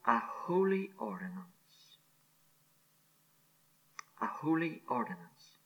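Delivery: hushed emphasis. Real, not acted. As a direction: breathe the words holy ordinance. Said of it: "I emphasize".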